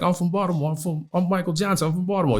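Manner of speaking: high Baltimore East Coast voice; East Coast accent. Retold or imitated. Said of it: "He's got the East Coast accent".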